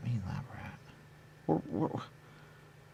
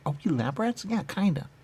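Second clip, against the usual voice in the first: ordinary delivery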